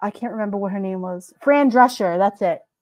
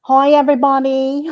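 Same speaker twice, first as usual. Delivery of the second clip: higher, louder put-on Fran Drescher greeting; Fran Drescher impression; 5 semitones higher, 7 dB louder than usual. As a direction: do her trademark TV-star hello. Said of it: "She would talk like this".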